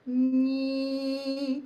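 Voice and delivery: slow nasal nee, buzzing in the nose. Shown as a demonstration. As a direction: drag out nee through the nose. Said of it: "This should be kinda nasal".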